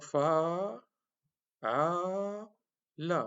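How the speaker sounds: "fa", "a", "la" with drawn-out long a; long vowels. Shown as a demonstration. Teacher demonstrating the madda lengthening. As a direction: stretch each "fa a la". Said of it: "symboliser les voyelles lettres".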